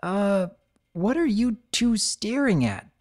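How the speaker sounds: nervous. Acted. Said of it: "She blinked nervously".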